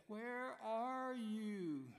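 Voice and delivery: high, very slow call into the dark. Retold or imitated. Husband searching the dark yard for the drunk, a slow high call. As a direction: stretch the call out to find him.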